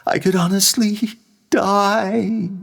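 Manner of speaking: plain heartfelt singing; mean every word; sincere sung line. Shown as a demonstration. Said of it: "And then with sincerity".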